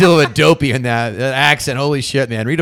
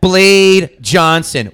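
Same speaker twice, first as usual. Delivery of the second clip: louder, drawn-out name; slow proud announcement; 5 semitones higher, 5 dB louder, much slower than usual. Acted. Blade shows his license, proudly slow. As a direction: announce it like a title.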